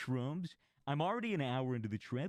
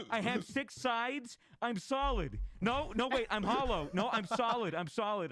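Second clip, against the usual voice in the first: much higher, slow and bewildered; tripping friend; 9 semitones higher, 3 dB louder than usual. Retold or imitated. Friend on shrooms explaining he has six sides, high and slow. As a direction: muse on it like a confused tripper. Friend describing being a cube.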